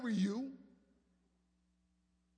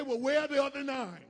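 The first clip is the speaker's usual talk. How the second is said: exasperated parent; 3 semitones higher, 7 dB louder, faster than usual. Retold or imitated. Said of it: "I'm gonna say".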